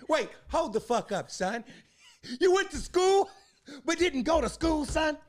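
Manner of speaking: put-on high, loud New York accent. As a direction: play a disbelieving New Yorker. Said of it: "can I put on my New York accent".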